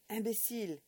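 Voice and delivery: loud, cutting French insult; angry pronouncement. Acted. Old man shaking the boy, calling him imbécile angrily. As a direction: spit the word out as you shake him. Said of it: "he pronounced between shakes".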